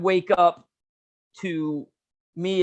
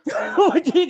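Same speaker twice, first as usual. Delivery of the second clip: quoting him while laughing, much higher; laughing imitation; 10 semitones higher, 4 dB louder, faster than usual. Teller laughing as he repeats his buddy's question. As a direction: crack up mid-quote. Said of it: "narcissist says".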